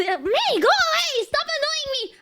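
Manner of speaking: high, whiny mock irritation; annoyed younger brother. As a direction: imitate a kid brother shooing you off.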